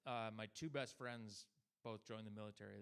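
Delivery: normal talking voice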